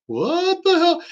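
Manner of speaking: very high, incredulous squeal; astonished disbelief. Retold or imitated. Passenger to cousin on seeing Puerto Rican flags, astonished. Retold shock at sudden new flags.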